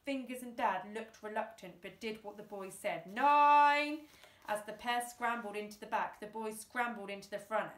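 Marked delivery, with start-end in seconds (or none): 3.0-4.1 a louder counted call. A narrator's reading. Sergeant calling the countdown over a loud hailer, loud. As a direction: bark out the countdown number.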